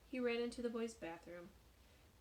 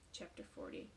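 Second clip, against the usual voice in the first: plain reading voice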